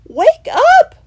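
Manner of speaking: very high, very loud shout; urgent cry. A narrator's reading. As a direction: wake them up with a desperate cry. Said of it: "Christopher cried urgently".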